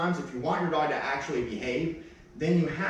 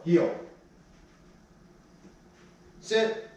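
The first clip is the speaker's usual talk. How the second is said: high, crisp sit command; firm command; 3 semitones higher than usual. Real, not acted. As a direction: make her sit at once. Trainer commands dog.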